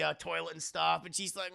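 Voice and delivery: usual speaking voice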